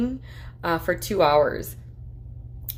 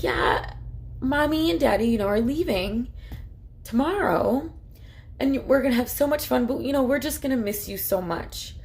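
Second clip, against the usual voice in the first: reassuring mom; 7 semitones higher than usual. Retold or imitated. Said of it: "And it's like".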